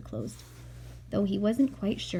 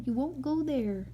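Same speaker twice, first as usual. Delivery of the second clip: ordinary delivery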